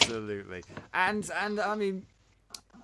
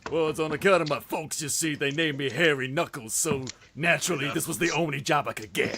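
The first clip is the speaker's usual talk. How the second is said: hammed-up American character voice; mock American drawing out a tale of woe; put-on American accent; 3 semitones lower than usual. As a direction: answer in a comically bad American accent. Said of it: "pitch perfect American accent".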